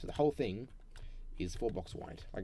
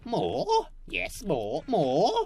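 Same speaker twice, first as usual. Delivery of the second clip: hammed-up greedy voice; 9 semitones higher, 8 dB louder, much slower than usual. Greedy mock.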